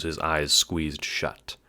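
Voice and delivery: ordinary delivery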